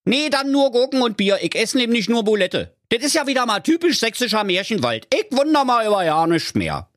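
very high, cartoonish bear with a Berlin twang; a lost, grumbling Berlin bear, voiced high and comic; squeaky bear talking Berlin slang. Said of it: "Da sprach der Berliner Bär".

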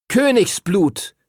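a high, slow, drawn-out call across the hills. Acted. Boy calls his dragon's name across the hills, drawn out. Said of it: "rief er".

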